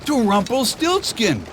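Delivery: high, fast, chattering donkey; Donkey's voice. A narrator's reading. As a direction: rattle it off, baffled. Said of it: "replied Donkey".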